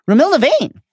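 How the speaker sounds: ordinary delivery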